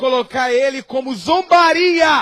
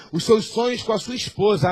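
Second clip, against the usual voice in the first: usual speaking voice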